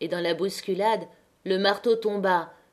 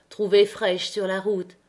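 ordinary delivery, nothing marked

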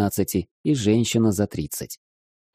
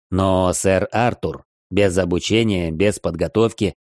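plain reading voice

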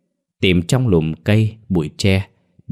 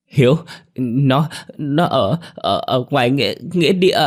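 put-on high, halting child's voice, choked with sobs; terrified sobbing stammer; 7 semitones higher than usual. A narrator's reading. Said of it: "khóc nấc lên nấc xuống, mới nói mãi được một câu". (bé con nấc nghẹn, lắp bắp sợ hãi)

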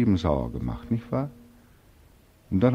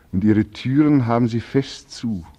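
bitter outburst; 4 semitones higher, 7 dB louder than usual. Acted. Bitter outburst at closed doors.

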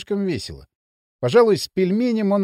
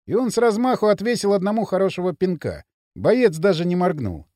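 plain reading voice

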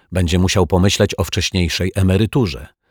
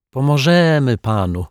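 put-on higher-pitched girl chiming in; lightly voiced little girl; blushing girl; 7 semitones higher than usual. A narrator's reading. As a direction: offer help like a bashful child. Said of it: "wtrąciła zarumieniona Zuzia".

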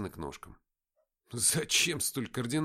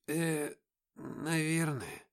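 slow, hesitant, higher mumble; мямлит; 5 semitones higher, much slower than usual. A narrator's reading. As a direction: mumble unsurely with long hesitation. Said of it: "промямлил сержант". (неуверенно мямлить)

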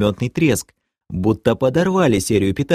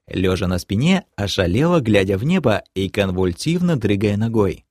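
ordinary delivery, nothing marked

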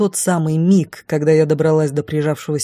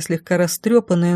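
usual speaking voice

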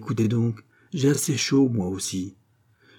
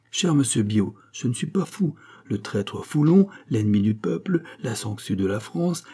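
ordinary delivery

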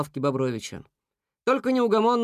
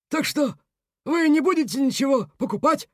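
high, bewildered beaver; taken aback; 10 semitones higher than usual. A narrator's reading. Beaver asking angry animals about buying, baffled. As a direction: ask in high-pitched confusion. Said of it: "Бобёр опешил от такого напора".

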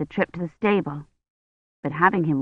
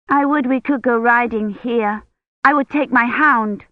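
lightly voiced young girl, higher; a child boasting about her horse, higher pitched; little girl voice; 6 semitones higher than usual. Young Agnes tells Rosamond about riding in Bath, a lightly voiced girl.

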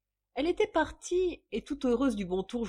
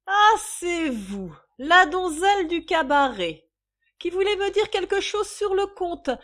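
high, loud angry accusation; furious outburst; 9 semitones higher, 10 dB louder than usual. A narrator's reading. Mistress turning on the shy visitor, furious. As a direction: round on her at full voice. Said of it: "Elle eut un coup de folle colère".

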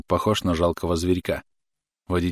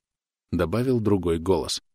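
usual speaking voice

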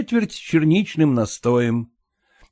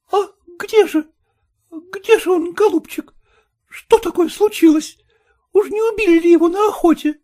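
anxious exclaiming. A narrator's reading. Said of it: "воскликнула".

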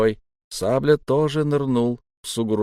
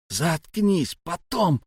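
high, strained order to be silent; urgent hushing; 7 semitones higher, much slower than usual. A narrator's reading. Sablya shutting up his insistent comrade, urgently hushing. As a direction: cut him off urgently.

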